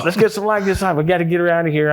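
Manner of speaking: high-pitched, retold urgency; urgent command. Investigator ordering team to rescue a retching colleague, urgent.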